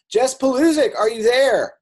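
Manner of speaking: high, loud call across the room. Real, not acted. Host calling out to find Jess on the call, loud and high. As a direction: call her name like she's far away.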